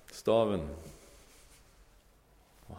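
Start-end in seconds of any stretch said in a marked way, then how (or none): 0.1-0.7 a firm one-word command as God; God's command. Acted. Preacher voicing God's command to Moses. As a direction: hand down the order, firm and a little louder.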